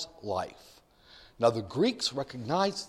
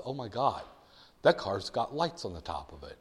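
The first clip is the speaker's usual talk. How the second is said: hushed realization; 8 semitones lower, 8 dB quieter than usual. Acted. Driver suddenly spots police car.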